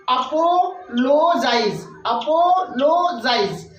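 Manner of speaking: "Apologise" slowly, in three drawn-out chunks; split into three parts. Shown as a demonstration. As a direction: break it as अपो, लो, जाइज. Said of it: "तीन बार हम रुक रहे हैं".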